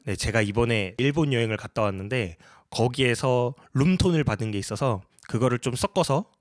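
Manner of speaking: ordinary talk, nothing marked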